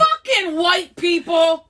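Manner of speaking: loud, slow exasperated curse; exasperated outburst. Acted. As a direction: bellow it out in disgust. Outburst at the start.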